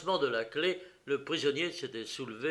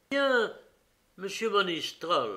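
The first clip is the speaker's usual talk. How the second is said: higher, louder, firm official tone; detective addressing the prisoner; 7 semitones higher, 6 dB louder than usual. Acted. Detective Méchinet speaks to the sobbing prisoner, firm official voice. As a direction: address him with steady authority.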